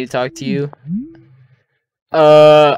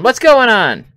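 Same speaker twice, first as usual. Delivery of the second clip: high, loud and panicked; alarmed shout; 11 semitones higher, 10 dB louder, slower than usual. Real, not acted.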